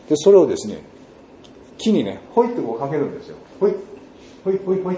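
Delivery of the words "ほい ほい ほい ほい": high, short "hoi" for each toss; light tossing calls. Retold or imitated. Villager slings washing over branches, light tossing calls. Mimics tossing laundry onto trees.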